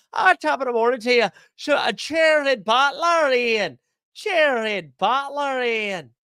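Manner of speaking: high, slow, hammed-up stage Irish calling out Butler; mock Irish accent. Streamer riffing on player names to Ian in a hammed-up Irish accent. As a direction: drag out "Chairhead Butler" in a loud mock brogue. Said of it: "now it's Sco-, Scottish".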